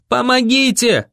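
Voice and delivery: high desperate cry; yelling for help. A narrator's reading. Boy yells on the run for help, yelling. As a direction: call out across empty streets. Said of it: "на бегу я орал".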